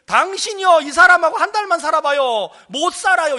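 high, loud fed-up protest; exasperated warning. Retold or imitated. Wife warning an envier about her husband, exasperated. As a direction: voice an exasperated wife, pitched well up.

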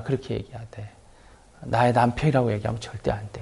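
whispered, hushed instruction; husband whispering a secret plan to his wife; conspiratorial whisper. Retold or imitated. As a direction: whisper it so nobody overhears. Abraham secretly instructs Sara.

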